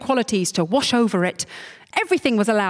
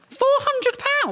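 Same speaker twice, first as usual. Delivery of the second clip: much higher, slow incredulous squeal; astonished; slower than usual. Real, not acted. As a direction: gasp at the price. Astonished at sum.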